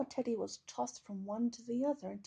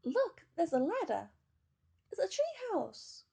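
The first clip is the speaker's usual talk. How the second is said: put-on excited child; 8 semitones higher, 6 dB louder than usual. A narrator's reading. Children finding Teddy.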